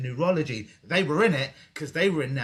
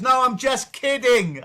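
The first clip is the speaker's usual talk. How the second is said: jokey; 10 semitones higher, 10 dB louder, slower than usual. Real, not acted. Teasing retraction of a joke.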